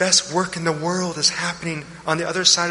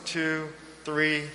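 slow breath counting; 2 semitones lower than usual. Shown as a demonstration. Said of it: "Try it with me".

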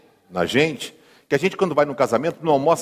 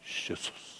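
breathy whispered Jesus; hushed reverent whisper of Jesus; 20 dB quieter than usual. Shown as a demonstration. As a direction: whisper the name Jesus as a secret. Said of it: "sussurra, né?".

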